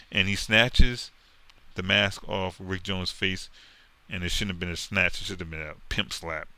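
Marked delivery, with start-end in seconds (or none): none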